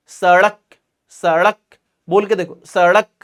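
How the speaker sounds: सड़क with a quick tongue flick; flapped ड़ in सड़क. Shown as a demonstration. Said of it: "जीभ ऊपर जा रही है और तुरंत फिर नीचे आ रही है". (सड़क में ड़ का झटकेदार उच्चारण)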